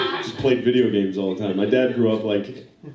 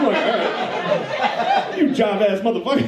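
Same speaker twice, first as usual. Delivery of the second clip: higher, laughing imitation of seventies jive talk; seventies street slang; 8 semitones higher, slower than usual. Uncle greeting his nephew, laughing imitation of seventies jive.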